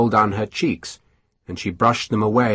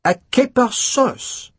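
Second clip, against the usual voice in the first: much higher, slow, appalled; disgusted echo; 12 semitones higher, slower than usual. A narrator's reading. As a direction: repeat it as if offended. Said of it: "Durand looked disgusted".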